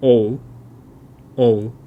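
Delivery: "o" gliding into a u sound; o-u sequence. Shown as a demonstration. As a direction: say "o" as o plus u. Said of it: "Japanese don't like this sound".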